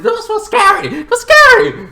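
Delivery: high-pitched frightened squeal; mock panic. Passenger after the truck scare, high mock panic. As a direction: squeak it out as if badly spooked.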